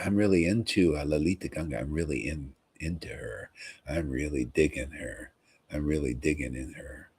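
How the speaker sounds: quietly sighing over his beloved, lightly voiced; mock lovestruck Lord. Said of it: "He's saying".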